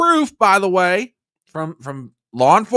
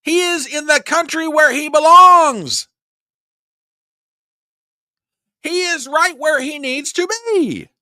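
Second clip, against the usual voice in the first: angry insistence; 8 semitones higher than usual. Real, not acted. Continued furious rebuttal.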